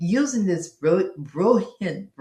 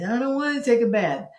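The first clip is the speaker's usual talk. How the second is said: put-on higher-pitched kid's protest; whining child; 5 semitones higher than usual. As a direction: whine about bath time like a child. Voices child refusing bath.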